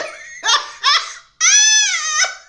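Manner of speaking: loud rising squeal of laughter; high shrieking laugh. Real, not acted. Reader still howling at Mona's joke. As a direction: let the laugh ride up high. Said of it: "She is funny as hell".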